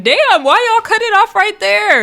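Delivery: high-pitched mock outrage at a cliffhanger; exasperated protest. Protesting the episode's cliffhanger ending.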